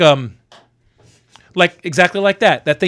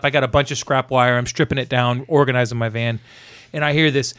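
normal talking voice

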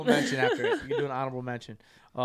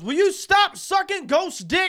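yelled; 9 dB louder, slower than usual. Retold or imitated. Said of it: "And my brother goes".

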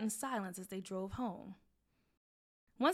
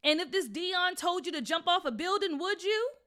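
scolding mom; 9 semitones higher, 8 dB louder than usual. Acted.

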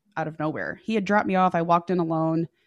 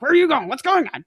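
hammed-up panic, pitch higher and louder; panicky mock drunk; 7 semitones higher, 5 dB louder, faster than usual. Drunk date bursting outside in panic, retold with mock alarm. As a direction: imitate the drunk date's frantic questions. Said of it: "like, panic mode".

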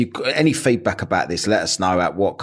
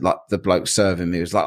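ordinary delivery

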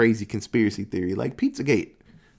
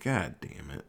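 retold resigned curse; 10 semitones lower, 11 dB quieter than usual. Man has stepped on a mine.

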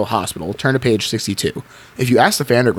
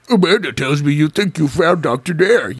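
monster voice; 3 semitones higher than usual. Acted. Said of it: "you say".

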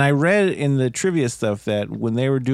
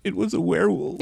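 David; 4 semitones higher, 4 dB quieter than usual. Acted. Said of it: "for David to be like".

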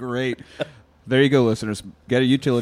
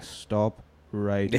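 softer, lower, very slow "Stop writing"; deflated repeat; 5 semitones lower, 7 dB quieter, much slower than usual. Acted. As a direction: let "Stop writing" sink, worn out.

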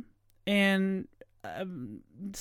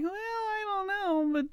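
high, soft, unsure "well, I don't know"; a bemused, shrugging aside; mock puzzled shrug; 12 semitones higher, 4 dB quieter than usual. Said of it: "I'm just like".

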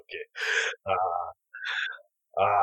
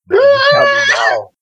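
demanding shout; 12 dB louder than usual. Acted. Arnie grabs Leo's head.

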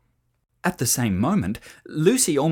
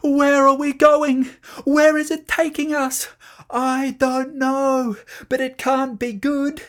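very high loud alarm; frightened shouting; 11 semitones higher, 6 dB louder than usual. A narrator's reading. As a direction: cry out while hurtling down. Children sliding down.

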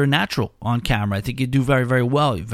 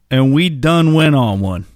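mimicking country drawl; 5 dB louder than usual. Host repeating caller's grammar in slow country drawl. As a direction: drag it out amused. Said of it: "I gotta stop it right there".

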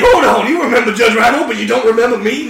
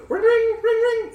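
high phone ring imitation; 11 semitones higher, 5 dB quieter than usual. Pretending to call the agency, high ringing phone voice. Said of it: "It's really weird".

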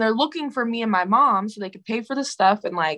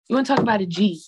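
usual speaking voice

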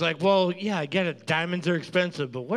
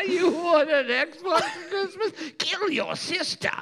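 laughing; 12 semitones higher than usual. Pretend. Replays whisper line, laughing high.

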